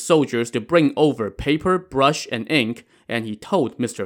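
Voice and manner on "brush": plain reading voice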